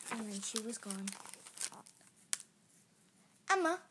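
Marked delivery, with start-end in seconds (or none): 3.5-3.8 high, louder sing-out of the name; coaxing call. A narrator's reading. Sibling calling little girl, coaxing. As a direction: call the child to coax her. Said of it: "I coaxed again".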